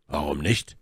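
ordinary delivery

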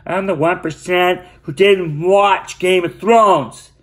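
high, slow, nasal mockery of a smug poster; sneering imitation of a smug online commenter; mocking smug non-fan. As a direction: parody the self-satisfied holdout. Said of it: "to the people like".

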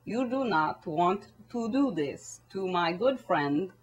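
put-on soft, slow Russian-accented Putin; quiet plea in a measured Russian accent. Putin sticking up for his good friend, soft Russian accent. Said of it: "good old Vladdy is like".

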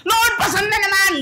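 high, loud whining complaint; mock complaint. A believer complaining to the Lord about another burden, high and loud. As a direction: groan it out to the Lord.

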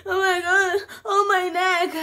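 high, loud wail of pain; hammed-up wailing over an injury; mock crying. Acting out crying on the way with a hurt neck, loud mock wail. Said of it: "On the way, I'm crying".

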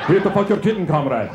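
put-on lightly accented tomcat asking a favor; a touch of a Russian accent, played for laughs. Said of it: "Great accent".

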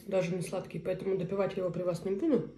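normal talking voice

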